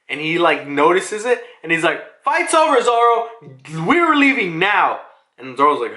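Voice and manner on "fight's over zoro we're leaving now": high-pitched order to a comrade; acted urgent call. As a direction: call it out as a retold command. Voices Jinbei urgently calling Zoro.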